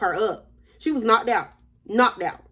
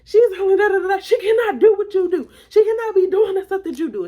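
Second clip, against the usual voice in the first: high, loud, relentless scolding; outraged motherly rant; mama yelling; 12 semitones higher, 5 dB louder than usual. Retold or imitated. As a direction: imitate mom lecturing at full pitch. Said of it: "So she's yelling at both of us".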